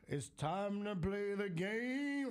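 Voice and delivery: high, slow drawn-out entrance call; Triple H entrance impression. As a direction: stretch it out like a wrestling entrance theme. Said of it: "I got the old school Triple H".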